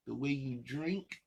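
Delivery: normal talking voice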